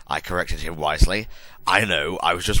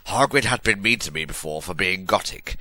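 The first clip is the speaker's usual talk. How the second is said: usual speaking voice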